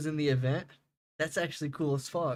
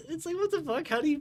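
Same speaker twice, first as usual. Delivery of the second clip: much higher, squeaked incredulity; high squeak of disbelief; 14 semitones higher, faster than usual. Real, not acted. Disbelief at a casting choice.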